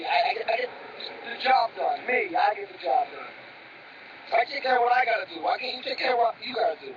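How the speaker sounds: loud, hammered-out shouting at his brother; angry shouting. Acted. Older brother yelling at Jay about doing his own work, angry shout. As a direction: shout it, pounding every word. Heated argument between brothers.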